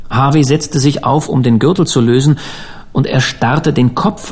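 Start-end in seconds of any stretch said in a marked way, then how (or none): none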